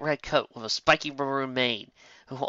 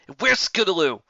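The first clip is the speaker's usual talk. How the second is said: demanding; 7 semitones higher, 7 dB louder than usual. A narrator's reading. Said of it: "Das demanded".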